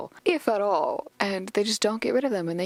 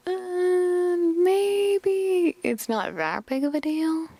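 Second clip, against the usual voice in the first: doubtful reaction; 9 semitones higher, much slower than usual. Retold or imitated. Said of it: "another thing that I'm like".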